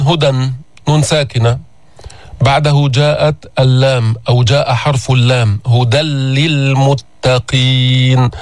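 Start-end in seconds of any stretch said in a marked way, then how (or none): 5.7-8.3 هدى للمتقين recited slow, tanween merged into a doubled لام, no nasal; doubled lam. Shown as a demonstration. Teacher models the verse for students, slow recitation. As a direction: chant slowly, no نون and no ghunna. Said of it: "هل تسمع النون؟".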